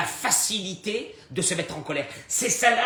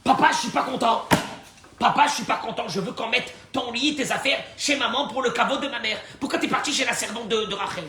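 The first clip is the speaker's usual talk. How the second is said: higher, louder whining son's plea; upset son complaining; 7 semitones higher, 4 dB louder than usual. Acted. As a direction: play the son protesting to his father. Son complaining to his father. (fils mécontent qui se plaint à son père, plus aigu et plus fort)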